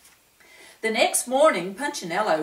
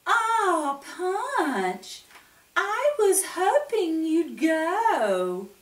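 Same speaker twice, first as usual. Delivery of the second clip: put-on high, slow sweet girl voice; Twiggy greeting Punch, high and sweet; 10 semitones higher, much slower than usual. A narrator's reading. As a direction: lure him sweetly, slow.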